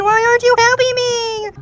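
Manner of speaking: high pleading cry; frightened plea. Real, not acted. Gamer begging a patient for help, high scared plea.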